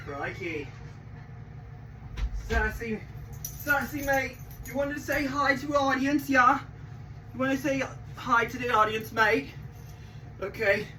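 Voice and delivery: a hint of an Aussie wildlife host; Australian accent. Australian slang with his pet.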